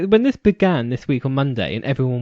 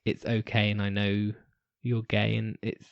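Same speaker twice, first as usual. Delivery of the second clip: low, soft and halting, imitating him working it out; hushed retold reassurance, slow with pauses; 4 semitones lower, 8 dB quieter, slower than usual. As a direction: softly voice someone struggling to say something accepting. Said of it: "cogs turning as he was trying to tell Callum".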